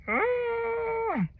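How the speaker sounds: stifled high cry, trying to hold it in; held-in cry. Retold or imitated. Woman in labor trying not to scream, stifled cry. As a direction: muffle the scream. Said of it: "I'm trying to hold it in".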